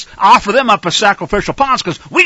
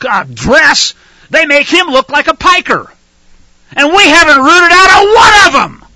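rising to a furious shout, much louder and higher; angry preaching; 6 semitones higher, 6 dB louder than usual. Real, not acted. Preacher denouncing lying spies to his listeners, furious shouting. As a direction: thunder at the congregation in real outrage. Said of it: "if you think I'm getting angry, you're right".

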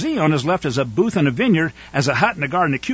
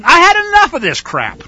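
indignant rebuke; 10 semitones higher, 4 dB louder than usual. Acted. Voicing God's rebuke.

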